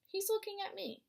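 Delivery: ordinary delivery, nothing marked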